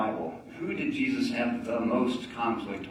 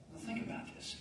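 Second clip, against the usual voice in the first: ordinary talk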